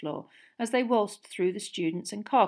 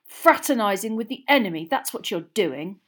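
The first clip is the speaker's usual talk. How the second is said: loud, indignant verdict; angry accusation; 3 semitones higher, 9 dB louder than usual. A narrator's reading. Boy branding his friend a traitor, angry. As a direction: land the charge hard and loud. Ron accuses Hermione in quarrel.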